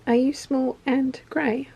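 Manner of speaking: usual speaking voice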